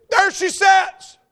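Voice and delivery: high, loud, drawn-out exclamation; amazed announcement. Acted. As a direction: cry it out slowly, imitating an excited convert. Acts out overjoyed man pointing out sister.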